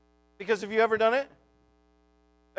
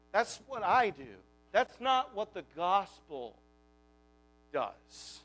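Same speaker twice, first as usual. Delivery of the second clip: normal talking voice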